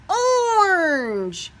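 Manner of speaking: high, loud, delighted reveal; ta-da the new colour; excited reveal. A narrator's reading.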